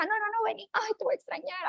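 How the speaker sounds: high, soft, whimpering farewell; mock tearful goodbye. Mother can't leave her child, mock tearful and high. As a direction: play the clingy mom near tears. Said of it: "hasta llora".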